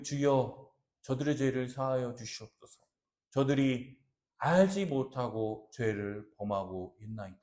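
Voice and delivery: lightly voiced, subdued prayer for others' forgiveness; Jesus praying on cross. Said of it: "십자가에 달리시면서 어떻게 하셨습니까".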